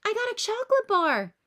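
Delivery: put-on high, loud excited girl; bursting with glee; thrilled high shout; exclaiming. A narrator's reading. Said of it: "exclaims Patty".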